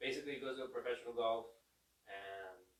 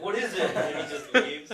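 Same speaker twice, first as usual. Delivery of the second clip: words broken by laughter, rising louder; laughing while telling; 5 semitones higher, 14 dB louder than usual. Real, not acted. Friend retelling the Grinch pudding scene, laughing and louder. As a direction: crack up while retelling the punchline.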